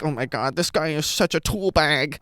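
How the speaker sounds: mocking Gen Z put-on voice; Gen Z cringe. Critic mocks the film's jokes, Gen Z cringe voice. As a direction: parody cringey youth humor. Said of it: "It's just Gen Z cringe humor of like".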